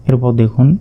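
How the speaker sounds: normal talking voice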